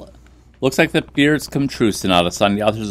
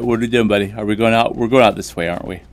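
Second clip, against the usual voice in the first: ordinary delivery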